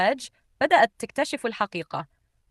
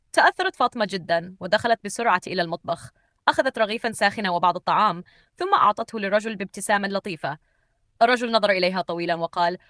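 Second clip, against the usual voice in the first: ordinary delivery, nothing marked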